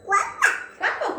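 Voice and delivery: high squeaky quacking; duck quack. Pretend. Parent quacking for a toddler's animal quiz, high and playful.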